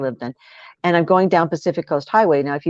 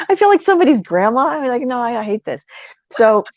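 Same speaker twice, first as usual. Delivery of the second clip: high, loud, laughing through it; amused outrage over being called ma'am; 8 semitones higher, 3 dB louder than usual. Real, not acted. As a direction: laugh at yourself, voice jumping up.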